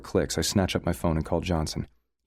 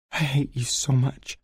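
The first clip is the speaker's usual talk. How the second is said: slow, high, tearful choke; choked out; 8 semitones higher, slower than usual. A narrator's reading. Sarah crying at the sink to Leo, slow choked voice. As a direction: force it out through tears. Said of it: "she chokes out".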